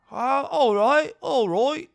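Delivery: a lightly put-on, high British accent; bad British accent. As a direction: play a clumsy Brit psyching himself up. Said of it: "I don't know how to do a British accent".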